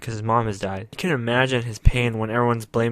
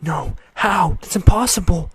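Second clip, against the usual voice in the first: higher and louder, rising panic; freaking out; 7 semitones higher, 5 dB louder than usual. Retold or imitated. Daughter hearing about her real father, panicked disbelief. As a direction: voice the disbelief climbing into a panicked cry. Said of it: "And she freaks out".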